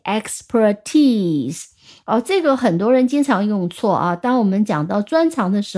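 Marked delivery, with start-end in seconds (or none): 0.0-1.7 "expertise" in separated syllables, long stressed TISE; stress on last syllable. Shown as a demonstration. Teacher repeating the reading, "expertise" stressed on TISE. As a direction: land firmly on the end of "expertise". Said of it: "就把读音放在最后一个音节".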